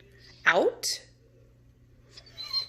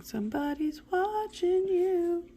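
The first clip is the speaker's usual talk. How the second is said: higher, slow creepy sing-song; spooky tease; 12 semitones higher, 5 dB quieter, slower than usual. Pretend. Teacher teases her class, high slow spooky voice. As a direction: tease them like a ghost.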